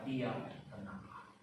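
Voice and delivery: soft, low, slow, quiet order; Jesus stilling the storm, once, quiet and slow; calm command. Retold or imitated. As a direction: say it once, gently and certain. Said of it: "dengan tenang dan tidak diulang".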